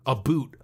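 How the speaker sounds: mock-Canadian "about" with an oo vowel; "about" said as "a boot". Shown as a demonstration. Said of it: "Americans think Canadians say a boot".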